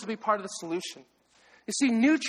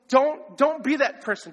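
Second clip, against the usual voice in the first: ordinary talk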